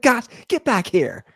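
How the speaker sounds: high-pitched flustered calling. Retold or imitated. Neighbor to his runaway dog, high mock exasperation. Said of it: "he's like".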